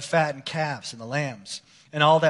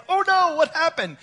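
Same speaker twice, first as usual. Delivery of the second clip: much higher mock panic, hammed up; mock panicked God; 12 semitones higher than usual. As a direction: play God caught off guard, comic alarm. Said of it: "He's like".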